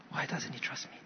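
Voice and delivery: a soft whispered sigh of hurt. Retold or imitated. Hurt dad to himself, whispered sigh. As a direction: breathe it out, wounded.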